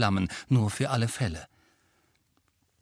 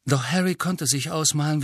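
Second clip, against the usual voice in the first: ordinary delivery, nothing marked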